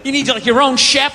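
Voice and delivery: ordinary talk, nothing marked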